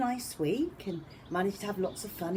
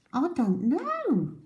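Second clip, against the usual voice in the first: high, slow squirrel voice; a puzzled little squirrel; 9 semitones higher, slower than usual. A narrator's reading. Squirrel answering the deer, high and slow. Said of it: "said a squirrel".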